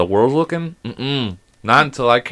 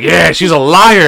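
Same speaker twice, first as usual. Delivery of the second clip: loud, higher, drawn-out taunt; retold yell; 6 semitones higher, 8 dB louder, slower than usual. Dad backing his daughter's accusation, retold loud taunt. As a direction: back the accusation up loudly and slowly.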